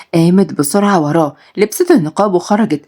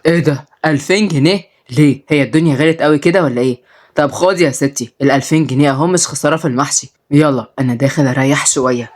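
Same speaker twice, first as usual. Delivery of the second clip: put-on lower, easygoing old man; old grandpa; 4 semitones lower than usual. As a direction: talk like a fond grandfather, low. Grandpa surprised at price.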